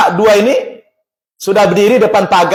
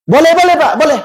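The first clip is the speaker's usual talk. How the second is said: quick-to-agree, high-pitched reassurance; retold eager yes, voice lifted high; eager consent; 9 semitones higher than usual. Acted eager consent to a request.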